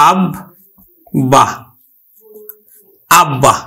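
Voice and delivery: আব-, then বা, then the whole আব্বা with a long ব; syllable by syllable. Shown as a demonstration. Teacher spelling out আব্বা for pupils slowly. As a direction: build আব্বা from its two syllables. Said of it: "আমরা উচ্চারণ করি কিন্তু".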